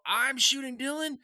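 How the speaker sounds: high mock holler; retold yell. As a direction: call back to a friend out of sight. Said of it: "I yell".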